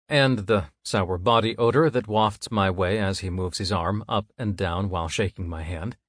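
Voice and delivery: plain reading voice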